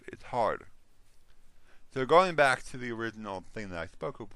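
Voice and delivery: normal talking voice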